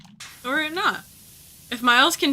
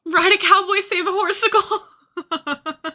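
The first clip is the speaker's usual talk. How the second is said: high, loud, laughing rhyme; laughing joke; 8 semitones higher, 5 dB louder, slower than usual. Real, not acted. Streamer joking to chat while laughing. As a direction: giggle through the silly rhyme.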